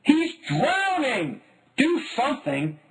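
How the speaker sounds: high, slow, pleading panic; mother panicking at the lakeside; panicked mom. A narrator's reading. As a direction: cry out in alarm, begging for help. Mom panicking as duck sinks.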